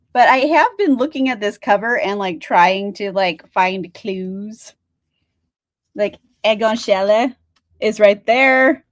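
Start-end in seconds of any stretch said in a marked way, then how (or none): none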